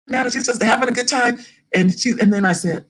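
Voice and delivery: normal talking voice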